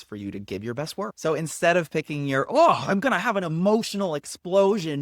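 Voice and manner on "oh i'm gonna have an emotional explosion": high, loud hammed-up melodrama; mock emotional explosion. Coach mocking dramatic monologue picks, hammed-up loud outburst. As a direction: overact a big emotional outburst in mockery. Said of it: "monologue that you might want to pick".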